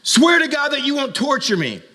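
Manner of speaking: high, loud mock terrified plea; mock terror. Preacher retelling his fright at the film, high mock terror. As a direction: yelp it like a scared moviegoer. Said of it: "and I'm like".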